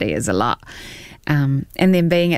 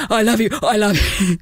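gushing fan; 4 semitones higher, faster than usual. Retold or imitated.

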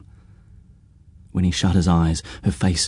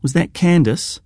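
surprised; 12 semitones higher than usual. Acted. Danny surprised by the name.

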